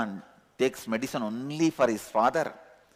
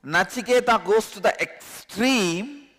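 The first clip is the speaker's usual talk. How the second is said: higher, louder building sermon; impassioned preaching; 8 semitones higher, 4 dB louder than usual. Acted. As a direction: preach with rising fervor. Preaching with rising force.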